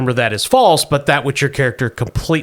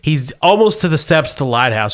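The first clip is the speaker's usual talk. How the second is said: ordinary talk, nothing marked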